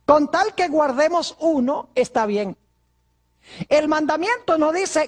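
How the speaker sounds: slow, drawn-out objecting churchgoer; lightly voiced doubter, dragging the words; a hint of a whiny objector; mock objector. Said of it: "Algunas personas dicen".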